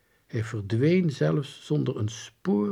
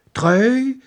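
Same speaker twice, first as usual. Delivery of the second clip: high, loud puzzled echo; baffled soldier's question; uncomprehending; 12 semitones higher, 9 dB louder than usual. A narrator's reading. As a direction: repeat it like an unknown foreign word. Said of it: "alsof het IJslands was".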